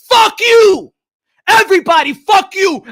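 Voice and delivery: high, loud cursing outburst; furious shout. Real, not acted. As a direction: yell it at every critic. Cursing out his critics.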